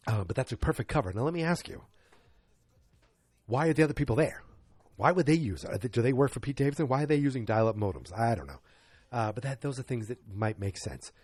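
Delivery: ordinary delivery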